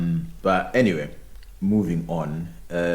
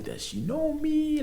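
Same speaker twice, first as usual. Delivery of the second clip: surprised; 4 dB quieter than usual. Retold or imitated. Retelling surprise at stranger.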